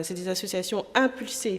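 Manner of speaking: ordinary talk, nothing marked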